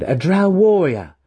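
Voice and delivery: high, loud, emphatic claim; proud declaration. A narrator's reading. Student claiming his title before his mentor, proudly declaring. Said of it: "he declared".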